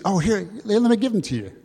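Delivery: high, lively acted offer; eager impulsive giver. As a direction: play someone giving on impulse.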